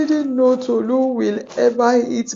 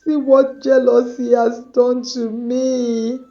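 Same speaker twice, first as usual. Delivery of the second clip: crying; 5 semitones higher than usual. Acted. Tayo cries in remorse.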